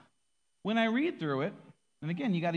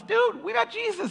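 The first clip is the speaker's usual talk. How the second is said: very high, drawn-out, thrilled announcement; excited news; 6 dB louder than usual. Retold or imitated. Disciples telling Thomas the news, very high and excited. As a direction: blurt it out overjoyed and slow.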